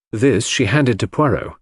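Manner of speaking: ordinary delivery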